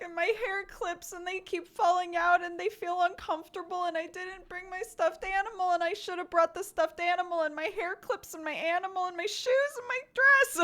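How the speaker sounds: high-pitched run-on whine; complaining little kid. Retold or imitated. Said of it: "while she complained about".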